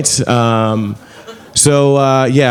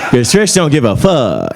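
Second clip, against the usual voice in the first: normal talking voice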